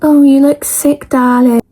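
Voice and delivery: high, slow sugary sympathy; hammed-up fake concern; mock concerned invigilator. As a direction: imitate a patronising teacher. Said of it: "They're like".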